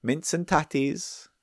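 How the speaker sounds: hammed-up Scottish accent. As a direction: spoof Scot rolling out stock phrases. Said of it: "how you start a Scottish accent impression".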